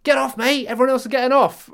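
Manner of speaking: high, loud re-enacted urging; urgent acted call. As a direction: call out as the pushy passenger. Acts out man tugging his arm.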